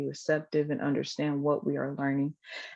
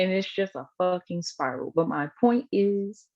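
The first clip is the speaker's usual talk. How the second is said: normal talking voice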